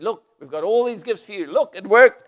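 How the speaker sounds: high, very loud jubilant cry; thrilled healed general; excited. Acted. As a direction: burst out with the good news. Naaman returns healed, thrilled.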